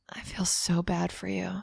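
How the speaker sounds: imitated hushed, pitying whisper; mock sympathetic whisper; whispered sympathy. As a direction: whisper it like an over-sympathetic doctor.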